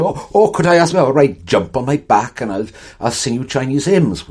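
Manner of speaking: high, loud South Wales singsong; Welsh relatives. Retold or imitated. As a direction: sing it up like a Welsh aunt in the valleys. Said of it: "My mother's family were from South Wales".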